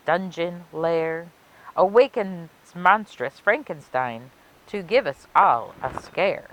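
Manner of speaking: put-on lilting rhymed read-aloud for children; storybook narration. A narrator's reading. Librarian reading a Halloween rhyme to kids, storybook lilt. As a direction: savor each rhyme like a spooky bedtime tale.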